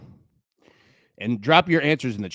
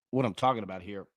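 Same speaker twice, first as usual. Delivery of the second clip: usual speaking voice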